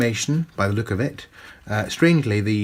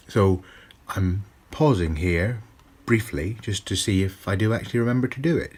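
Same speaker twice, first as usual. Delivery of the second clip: usual speaking voice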